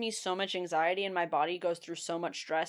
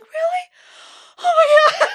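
very high, loud amazed squeal; relieved surprise; 14 dB louder than usual. Retold or imitated. Learning the shot was already done, high loud amazed squeal. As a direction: squeal it in shock. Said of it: "And I was like".

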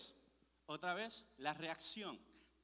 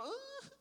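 high, strained grunt of frustration; a pent-up, exasperated groan; fed-up kid's strained cry; frustrated grunt; 3 dB louder than usual. Retold or imitated. Frustrated in bed, being bullied.

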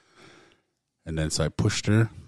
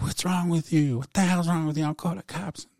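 angry wife; 11 semitones higher than usual. Retold or imitated. Wife confronting him outside.

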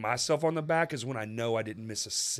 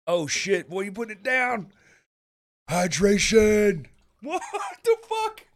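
frat bro; 8 semitones higher, 5 dB louder than usual. Real, not acted. Hyping buddy chugging a can.

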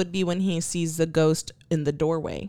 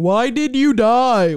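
loud, slow, raised investigator's call; mock shout; 5 semitones higher, 9 dB louder, slower than usual. Co-host mimicking investigators questioning a ghost, mock shout. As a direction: call it out loudly, dragging each word.

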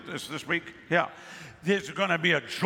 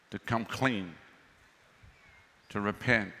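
tender pleading; 6 semitones lower than usual. Real, not acted. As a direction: coax them toward repentance. Preacher softens to plead.

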